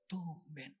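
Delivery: TUMBEN low, soft and drawn out; wondering inner thought. Retold or imitated. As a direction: wonder quietly to yourself, stretching the word. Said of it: "hati saya bertanya-tanya". (bertanya-tanya dalam hati, pelan)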